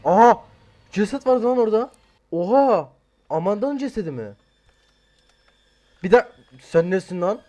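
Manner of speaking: high, loud startled outcry at a corpse; startled shout. Real, not acted. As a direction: jump at the body you just found. Jumpscare finding a corpse.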